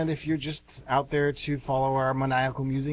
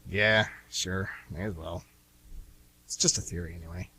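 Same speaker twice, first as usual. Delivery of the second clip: resigned; 8 semitones lower than usual. Acted. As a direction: give up with a sigh in the voice. Deflated resignation.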